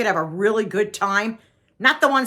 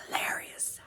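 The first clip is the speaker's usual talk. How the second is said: hushed whisper, all breath; barely voiced whisper; 13 dB quieter than usual. Real, not acted. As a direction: whisper it as an aside. Same word whispered, then drawn out.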